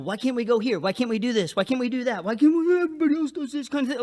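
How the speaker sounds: mimicking restless people begging to go out; mock whining complaint. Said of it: "there's a lot of people".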